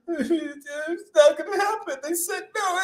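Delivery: high, whiny mock sob with a laugh; mock crybaby whine. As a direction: whimper it like a crybaby fan, laughing. Mocking defeated fans.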